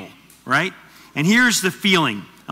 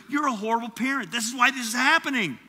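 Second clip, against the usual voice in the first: blaming spouse; 6 semitones higher than usual. Retold or imitated. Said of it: "you go".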